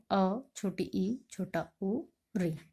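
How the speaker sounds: अ, छोटी ई, छोटा उ sounded out slowly and clearly; slow clear vowel demo; short vowels demo. Shown as a demonstration. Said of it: "ये चार हैं".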